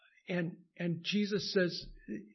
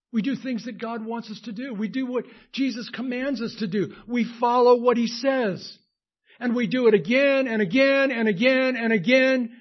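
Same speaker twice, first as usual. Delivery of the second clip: rising into full-force preaching, much louder and higher; preaching build; 6 semitones higher, 12 dB louder than usual. Real, not acted. Pastor to congregation, climbing to a loud, high preaching peak.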